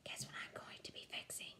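a secret, breathy whisper. Acted. Grandpa whispering a secret plan to his granddaughter. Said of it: "made his voice real quiet".

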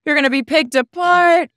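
high, loud pile-on of dread; playful wail of impending disaster; mock doom. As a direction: join in the hammed-up panic. Host joins in the mock doom.

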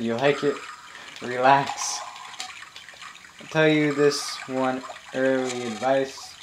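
ordinary delivery

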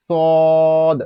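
সদ held long and loud, bouncing the final d; long level সদ with a released d; elongated with qalqala. Shown as a demonstration. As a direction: stretch সদ, then echo the last consonant. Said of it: "কলকলা".

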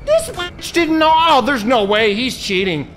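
loud, high, drawn-out disbelief; outraged. Real, not acted. Player accusing the game opponent of cheating, outraged. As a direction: cry foul at full volume.

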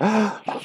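a soft, high gasp of surprise; a quiet mock gasp. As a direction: gasp lightly for the kids. Suspense before the answer.

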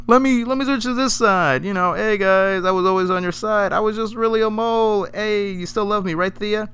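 high, chipper voice for a side-switching schemer; mock turncoat Malcolm Merlyn. Mocking Malcolm Merlyn switching sides, high and sly. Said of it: "He's like".

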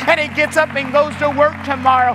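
full-force preaching. Acted. Pastor rallying his church on video, preaching loud and high. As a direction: preach it out, building and driving each line home.

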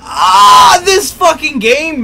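loud furious gamer yell; raging gamer. Real, not acted. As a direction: scream it in rage at the game. Rage after dying.

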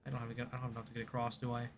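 ordinary delivery

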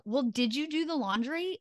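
mock reproach, starting high with a pointed lilt; accusing nag. Partner nagging about the laundry, accusing. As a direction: scold them in an exaggerated nag. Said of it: "as opposed to".

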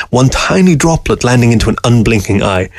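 ordinary delivery

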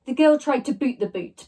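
ordinary delivery, nothing marked

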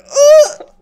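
high loud yelp at the slap; pained yelp. Retold or imitated. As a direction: cry out from the sudden hit. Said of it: "I'm like".

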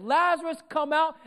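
high-pitched commanding call; loud calling out. Acted. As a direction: call the dead man out. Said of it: "Jesus called out with a loud voice".

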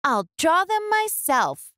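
put-on high, firm little girl; Ruby's spirited comeback, pitched high; determined Ruby. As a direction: say it with stubborn resolve. Ruby resolves alone.

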